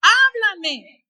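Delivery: loud, high, urgent plea; crying out to God. Acted. Believer crying out to God for guidance, mounting loud plea. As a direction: build to a shouted supplication. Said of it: "No quiere que tú clames a Dios".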